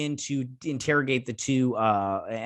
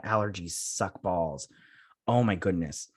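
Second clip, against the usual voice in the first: usual speaking voice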